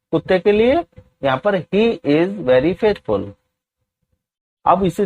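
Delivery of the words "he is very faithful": each word spaced out slowly for students to copy; very slow, deliberate classroom dictation. Shown as a demonstration. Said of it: "teacher dictates English sentence".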